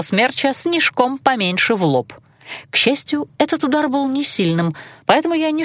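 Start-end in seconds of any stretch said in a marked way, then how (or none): none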